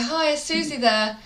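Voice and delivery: lightly acted caller asking for someone; chirpy phone caller. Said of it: "they say, you go".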